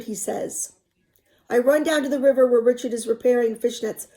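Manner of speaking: expressive read-aloud narration; story narration. A narrator's reading. Teacher reading a novel to students, expressive narration.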